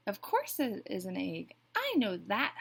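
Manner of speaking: a touch of huffy impatience; mildly huffy. A narrator's reading. Duck answers Goose about the egg, mildly huffy. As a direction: huff it out, lightly exasperated. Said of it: "huffed Duck".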